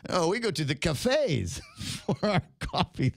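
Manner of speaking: a lightly voiced snob, slow and haughty; snooty snob voice. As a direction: sniff at the hoi polloi like a hammed-up aristocrat. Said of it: "you have to say it like that".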